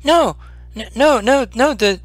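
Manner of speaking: high stammering creature voice insisting; eager little creature promising safety; earnest kobold. Acted. Kobold Meepo assuring the adventurers the dragon is nice, high and eager.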